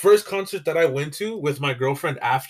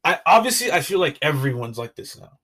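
ordinary talk

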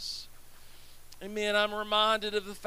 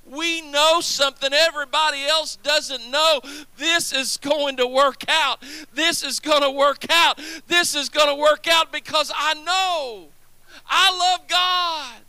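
louder and louder, hammering out each phrase; preaching build; 2 semitones higher, 5 dB louder than usual. Real, not acted. Preacher promising the congregation it will work out, building to a full-voiced cry. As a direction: drive the promise home to the congregation.

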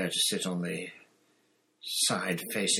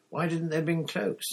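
higher-pitched, mildly irritated question; irritable; 9 semitones higher than usual. A narrator's reading. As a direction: complain with impatience. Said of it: "said Hermione irritably".

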